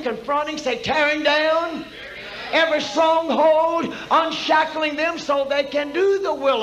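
loud, driving revival preacher; full-force preaching. Real, not acted. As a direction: hammer out each phrase to rouse the congregation.

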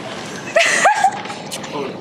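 squealing laugh. Real, not acted. Laugh at balut reveal.

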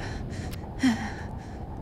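long unvoiced sigh of exhaustion; worn-out sigh after the fall; exhausted sigh. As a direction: breathe out, spent and hurt. After tripping while fleeing.

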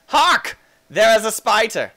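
high, loud herald's cry; mock-heroic alarm. Player announcing a spider, mock-heroic alarm. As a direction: proclaim the danger theatrically.

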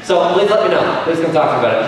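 normal talking voice

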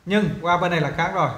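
usual speaking voice

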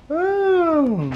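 normal talking voice

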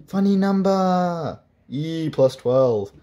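high, slow amused demo voice; goofy engineer voice. Retold or imitated. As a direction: show off a silly calculator trick. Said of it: "like, saying".

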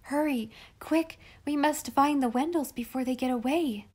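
agitated. A narrator's reading. Betsy frightened, hurrying Molly to find the Wendells. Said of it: "In her agitation".

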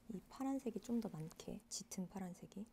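soft whisper to herself; focused whisper. Real, not acted. Crafting a flower album, whispering to herself. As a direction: whisper while concentrating hard.

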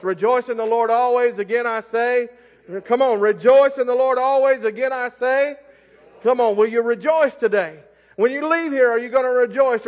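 calling "rejoice in the Lord always" like a rally cry; rising preacher exhortation. Shown as a demonstration. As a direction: push the crowd to answer back. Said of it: "repeats the verse, urging congregation".

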